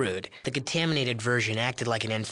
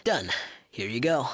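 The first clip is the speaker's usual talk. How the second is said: ordinary talk, nothing marked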